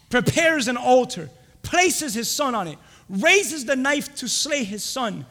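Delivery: loud, punched, stretched phrases; full-force preaching. Real, not acted. Preacher telling Abraham raising the knife, full-force preaching. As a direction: build the story with forceful pauses.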